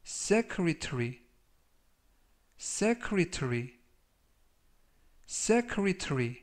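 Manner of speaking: very slow, rising with each repeat. Shown as a demonstration. Teacher repeating a vocabulary word slowly for students. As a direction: drill the word slowly, syllable by syllable. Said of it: "제가 천천히 세 번씩 읽어드리고요".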